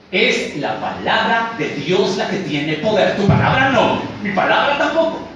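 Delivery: emphatic, climbing to high and loud; forceful sermon correction, building; preaching at full force. Real, not acted. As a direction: drive the contrast home from the pulpit.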